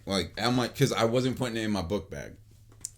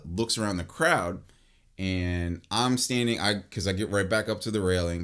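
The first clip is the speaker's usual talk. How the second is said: usual speaking voice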